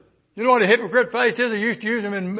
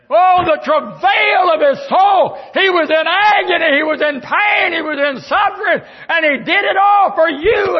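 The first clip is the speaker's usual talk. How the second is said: full-force shouted preaching; 8 semitones higher, 7 dB louder than usual. Acted. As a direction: hammer each line home.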